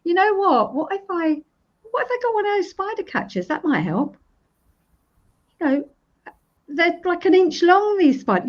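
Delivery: a hint of an eager client thinking aloud; perking up with an idea. Shown as a demonstration. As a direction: play the client hitting on a fix, a little lifted. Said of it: "you start rationalizing".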